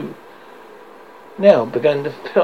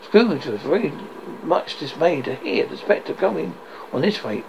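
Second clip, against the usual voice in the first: ordinary delivery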